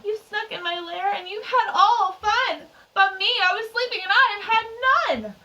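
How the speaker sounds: loud, high-pitched indignant complaint; mad bear. A narrator's reading. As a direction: play an aggrieved storybook bear, loudly. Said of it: "someone that mad".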